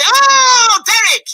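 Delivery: high, loud cry of the name; excited shout. Acted. Medium spotting Derek, a loud high shout. Psychic greets caller.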